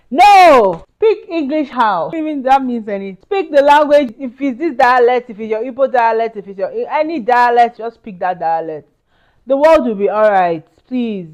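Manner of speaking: loud, high, impassioned rant; fired-up outburst. Real, not acted. Speaker to immigrants telling them to speak their dialect, loud and impassioned. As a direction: let it burst out, loud and high, fed up. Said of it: "The Igbo side of me just came out".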